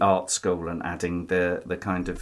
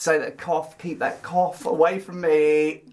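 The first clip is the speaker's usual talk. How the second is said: mock alarm; 8 semitones higher, slower than usual. Jokingly warding off illness.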